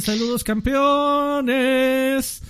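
high, slow drawn-out greeting; Mister Charlie voice. Retold or imitated. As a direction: stretch it out like a hype host. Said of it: "Mister Charlie, que dice". (voz alta y arrastrada de Mister Charlie)

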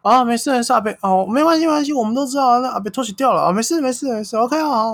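high, eager soothing peacemaker voice; imitating the peacemaker. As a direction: talk the driver out of calling police. Peacemaker quoted again.

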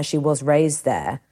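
usual speaking voice

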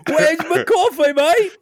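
slow, very high mock Cockney; exaggerated high Cockney complaint. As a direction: whine it in hammed-up Cockney. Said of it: "[name]'s accent started Cockney".

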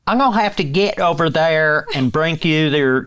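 a hint of Southern twang; Southern accent. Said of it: "Can you do it?".

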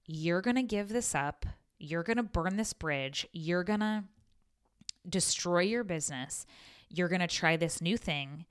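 ordinary delivery

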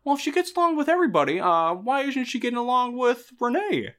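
a light mock of mom pouncing on the point; spring the logical trap on him; gotcha mom.

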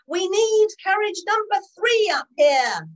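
high, loud call up the platform; yelling. Acted. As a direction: keep shouting to the driver. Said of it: "yelled Clarence".

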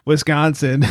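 normal talking voice

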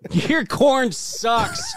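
high, drawn-out singsong taunt; mock echo. Echoing the friend's jab about his corn in a high slow singsong. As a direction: stretch sucks way up like a kid teasing.

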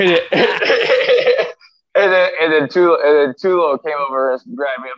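real laughter breaking through the telling; laughing. Real, not acted. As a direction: laughs through the words as he recalls the scuffle.

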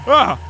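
high, loud yelp of fright; startled yelp. Acted. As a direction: yelp in sudden shock. Sudden fright in the dark.

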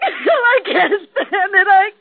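high, sobbing breakdown, voice breaking; wife sobbing that she can't stand it; crying. Acted. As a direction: break down in tears, pleading. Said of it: "Lorraine had her cry out".

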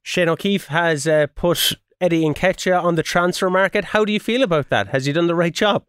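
low, quick post-match reporter; TV interviewer voice. Acted.

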